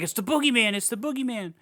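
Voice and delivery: put-on high, frightened little boy voice; scared kid. Kid Tommy seeing Michael outside, frightened and high. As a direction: sell it like a spooked child at the window. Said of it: "he's like".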